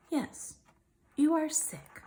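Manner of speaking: plain reading voice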